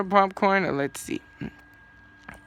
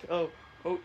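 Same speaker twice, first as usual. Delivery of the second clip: ordinary talk, nothing marked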